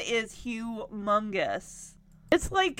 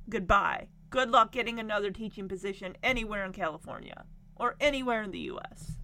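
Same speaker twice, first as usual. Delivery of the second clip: ordinary talk, nothing marked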